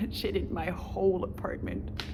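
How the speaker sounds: normal talking voice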